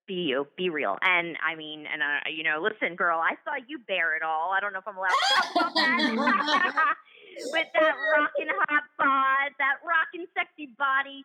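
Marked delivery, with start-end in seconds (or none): 4.6-11.2 laughing excitement. Real, not acted. Teasing guest about bold photos.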